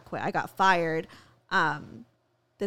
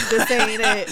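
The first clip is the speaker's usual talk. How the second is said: sassy universe voice; 7 dB louder than usual. Retold or imitated. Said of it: "the universe was like".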